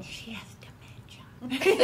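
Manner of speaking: laughing, dismissive whisper; mocking whisper. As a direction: imitate the neighbor with a laugh. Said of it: "she's going".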